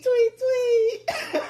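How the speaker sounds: high squeaky laughing tweet, tweet; cutesy tweet. Pretend. To Coco in a DM, a high giggly chirp. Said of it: "be like".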